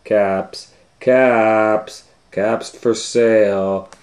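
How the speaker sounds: loud, slow street call; calling out wares. A narrator's reading. Peddler calls to buyers in town, slow loud street call. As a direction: call out to buyers, stretching the words. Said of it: "he went back to town calling".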